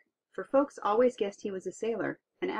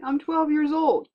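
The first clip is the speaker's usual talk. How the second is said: put-on higher, louder, slow boy's voice; boyish voice; 9 semitones higher, 6 dB louder than usual. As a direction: sound like a proud twelve-year-old. Giant explains he is a child.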